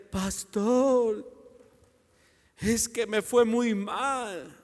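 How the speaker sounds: slow, drawn-out mock whine; imitating a woeful complainer; mock complaining. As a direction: drag it out like a sorry churchgoer. Imitates complaining church members to pastor.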